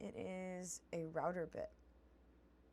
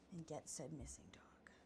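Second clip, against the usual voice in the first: whispering; 5 semitones lower, 11 dB quieter than usual. Real, not acted. Muttering about missing dog.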